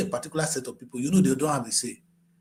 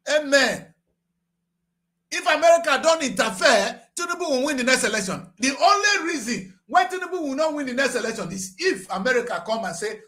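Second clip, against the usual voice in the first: ordinary delivery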